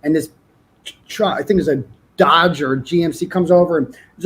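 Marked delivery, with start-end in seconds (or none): none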